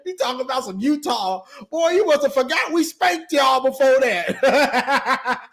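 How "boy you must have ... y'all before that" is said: slow taunt broken by laughter; laughing taunt. Real, not acted. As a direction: laugh while rubbing it in. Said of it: "laughing taunt".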